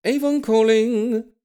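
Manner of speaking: high, loud, slow sing-song doorbell call; Avon calling jingle. Retold or imitated. Mocking the Avon advert jingle, high and drawn out. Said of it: "angry switch from one to a hundred".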